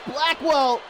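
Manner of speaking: ordinary talk, nothing marked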